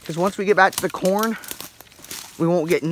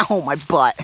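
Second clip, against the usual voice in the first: ordinary talk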